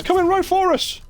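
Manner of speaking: high shouted cartoon panic; South Park quote. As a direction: yell it like a South Park hunter.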